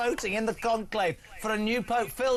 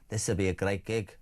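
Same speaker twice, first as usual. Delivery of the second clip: put-on much lower, broad Scouse accent; Scouse cardinal; 11 semitones lower than usual. Host voicing the cardinal's thoughts, in a deep Scouse accent. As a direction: play the Liverpool cardinal dreaming of the Vatican. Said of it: "stop doing this accent".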